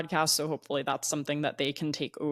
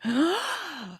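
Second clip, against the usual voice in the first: dramatic gasp; 11 semitones higher than usual. Retold or imitated. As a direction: gasp like an overreacting emoji. Imitating the shocked emoji.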